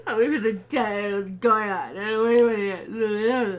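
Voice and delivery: loud, slurred hammed-up wailing; mock hysterics. Host imitating Alice babbling to the older woman, mock hysterics. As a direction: let the words dissolve into wailing. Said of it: "But she's like".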